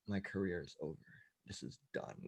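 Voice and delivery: breathy whisper, giving up; a comedian whispering that his career is over; whispered despair. Retold or imitated. Comedian to himself on stage, whispering in despair.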